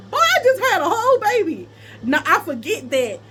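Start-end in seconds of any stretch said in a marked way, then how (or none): 0.1-1.7 high, loud, offended comeback; indignant retort. Retold or imitated. Woman retelling her answer to his HIV question, indignant. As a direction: throw it back at him, voice jumping up.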